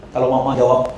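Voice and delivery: ordinary talk, nothing marked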